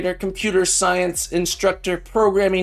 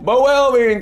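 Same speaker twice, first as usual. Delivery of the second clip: name called out high and loud; 9 semitones higher, 5 dB louder than usual. Acted. Streamer calls out a new follower's name, loud. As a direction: call the name out bright.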